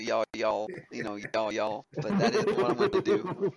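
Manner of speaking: drawled Southern Alabama y'all; Southern accent. Shown as a demonstration. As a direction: lay on the Southern accent, half laughing. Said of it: "I can do it if I want to".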